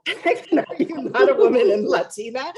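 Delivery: teasing question broken by a laugh; laughing quote. Retold or imitated. Friend laughing while asking if she isn't a woman in tech. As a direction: tease her through a laugh, high and loud. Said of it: "And she's like".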